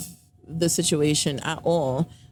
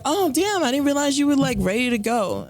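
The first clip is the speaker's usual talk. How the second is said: mock surprised girlfriend; 7 semitones higher than usual. Girlfriend's surprised reply retold.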